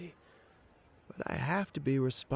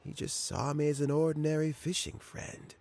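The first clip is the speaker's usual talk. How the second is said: normal talking voice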